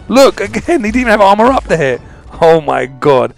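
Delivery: ordinary talk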